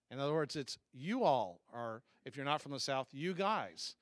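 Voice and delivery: ordinary delivery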